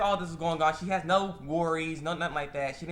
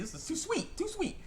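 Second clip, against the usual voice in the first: very high, soft squeal of distaste; squealed mock disgust; 5 dB quieter than usual. Imitating her reaction to the nasty cake, high mock squeal. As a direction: squeak it out like it's sickly sweet.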